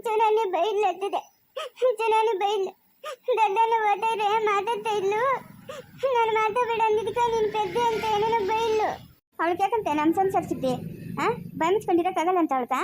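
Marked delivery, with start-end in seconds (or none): 0.1-8.9 whiny sobbing tattle; crying complaint. Acted. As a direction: sob out who scolded you. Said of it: "ಅದಕ್ಕೆಲ್ಲ ಅಳ್ತಾರಾ". (ಬಿಕ್ಕುತ್ತಾ ದೂರು ಹೇಳುವುದು)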